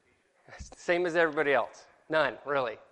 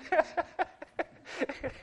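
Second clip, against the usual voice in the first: laughing it out, higher and louder; 11 semitones higher, 6 dB louder than usual. Real, not acted. Teacher laughing at his wrong language. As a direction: laugh through the excuse. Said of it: "Oh, Hebrew".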